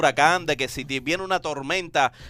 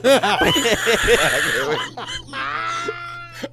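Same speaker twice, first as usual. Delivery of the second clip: long high cackling laugh; laughter. Real, not acted. Host cracking up after a joke about pricey kitchens, helpless laughter. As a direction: burst into helpless, squealing laughter.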